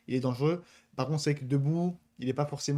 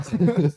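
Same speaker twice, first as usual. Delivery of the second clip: quick loud chuckle; short laugh; 5 semitones higher, 10 dB louder than usual. Real, not acted. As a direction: laugh it off briefly. Laughs at own self-mockery.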